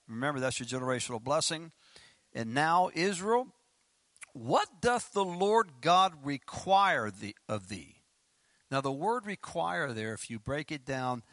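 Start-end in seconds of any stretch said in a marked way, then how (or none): 2.3-8.0 slow, declamatory Bible question; scripture reading. Acted. Preacher reading to the congregation, slow emphatic scripture question. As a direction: stretch the question out with weight, then drop at the end.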